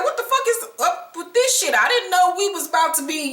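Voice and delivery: hammed-up outrage at the surprise; mock shocked Drewski. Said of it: "he like".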